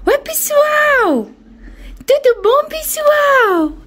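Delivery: squeaky high pet talk. Real, not acted. Bird greets the viewers.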